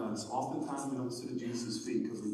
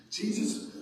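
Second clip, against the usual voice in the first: usual speaking voice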